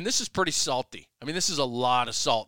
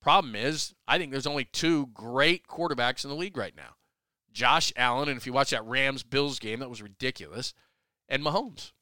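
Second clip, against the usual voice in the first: ordinary delivery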